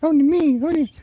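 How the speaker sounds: very high, loud jealous cat protest; voicing jealous cat. Streamer voicing her jealous cat Peppy, very high and whiny. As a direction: whine and fuss like a possessive pet. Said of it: "Peppy is like".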